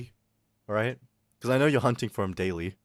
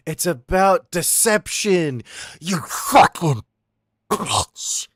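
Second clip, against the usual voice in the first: slow, louder outburst of frustration; venting yell; 5 semitones higher, 7 dB louder, much slower than usual. Real, not acted. Streamer venting at chat, real loud angry yell. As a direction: let the anger out, dragging the words. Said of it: "I need to let that out".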